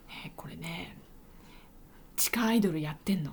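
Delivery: grandma's voiceless whisper, a little fast; whispered secret. Retold or imitated. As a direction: confide it in a hush. Grandma whispers in silent room. (静かな待合室でおばあさんが内緒話のようにささやく)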